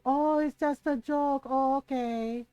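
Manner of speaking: slow, mocking echo; sarcastic. Mom to boys excusing the prank, sarcastic. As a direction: repeat their excuse with drawn-out mock acceptance.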